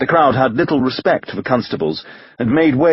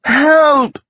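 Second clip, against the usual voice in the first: high, loud frightened shriek; woman shrieking from the fog for help; 5 dB louder than usual. A narrator's reading. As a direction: scream for help in terror. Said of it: "the woman shrieked".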